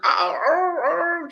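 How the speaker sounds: high, loud cries of pain; imitated crying. The doctor touches her where it hurts, imitated high crying. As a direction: cry out like the hurt one being examined. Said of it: "She's just crying".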